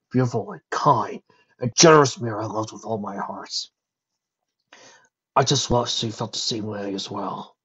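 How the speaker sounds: sighing over lost love; wistful dragon voice. Acted. Spike remembers his lost love.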